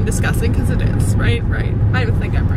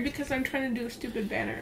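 tired whisper; 13 dB quieter, faster than usual. Real, not acted.

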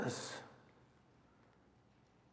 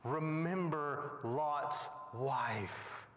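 very slow, grave telling; preacher slowing for gravity; solemn slow; 3 semitones lower, slower than usual. Real, not acted. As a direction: draw out each word with dread.

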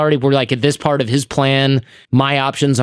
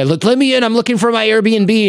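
much higher, mock pleading stranger voice; a hammed-up stranger begging to be let in; pushy stranger; 10 semitones higher than usual. Stranger at the door asking to be let in, mock high and pleading.

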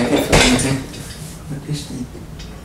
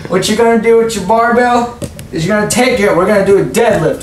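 high, drawn-out instructor voice; Gerald, workout director; 6 semitones higher, slower than usual. Pretend. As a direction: introduce the workout as a hammed-up trainer.

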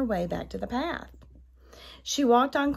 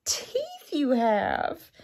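put-on high wondering girl voice; little girl voice. A narrator's reading. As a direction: innocent surprised child. Girl addresses disguised wolf.

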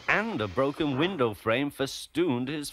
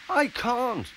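timid little engine; 13 semitones higher than usual. Acted.

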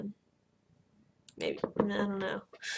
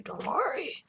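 hushed, soft reassurance; whisper; 3 semitones higher, 12 dB quieter than usual. Acted. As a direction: whisper it comfortingly. Soothing game son.

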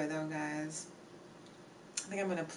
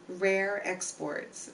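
slow and clear; much slower than usual. Shown as a demonstration. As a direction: spell out the title slowly. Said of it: "The name of the movie is".

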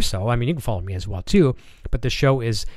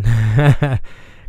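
short laugh; 7 dB louder than usual. Real, not acted. Laughs at chat joke.

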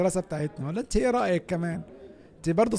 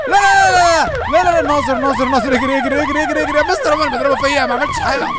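panicked screaming; 7 dB louder than usual. Real, not acted. Caught by principal, screaming.

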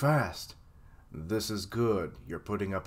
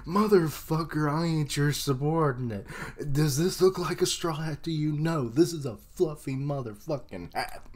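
mock angry Law; 5 semitones higher than usual. Law's angry voiced retort.

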